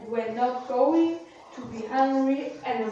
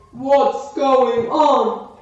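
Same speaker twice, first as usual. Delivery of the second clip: slow, louder giant roar; giant's shout; 6 dB louder, much slower than usual. Acted. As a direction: bellow like a woken giant. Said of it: "the giant woke up and shouted".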